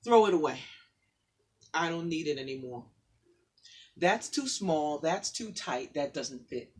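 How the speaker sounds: rhythmic spoken word, building in force; poem recital. Acted. Guest reading her poem to the audience, building spoken word.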